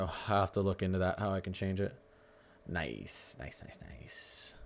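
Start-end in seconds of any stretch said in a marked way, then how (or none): 2.7-4.5 soft drawn-out murmur to himself; half-whispered, pleased, trailing off; murmured nice. Real, not acted.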